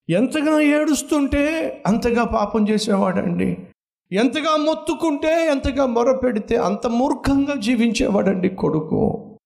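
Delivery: high, ringing pulpit cry; fervent preaching. Real, not acted. Preacher contrasts mother's tears with son's sin, fervent high preaching. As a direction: drive the contrast home with fire.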